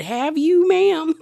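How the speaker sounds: high, loud sarcastic "ma'am?" rising up; incredulous sass. Real, not acted. The vlogger snaps back at Babe, loud incredulous sass. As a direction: challenge her, full of doubt.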